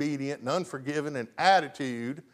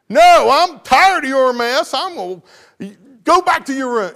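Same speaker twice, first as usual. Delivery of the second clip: angry mom; 9 semitones higher, 12 dB louder than usual. Acted. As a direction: shout it like a fed-up mom. Mom angrily refusing in sermon example.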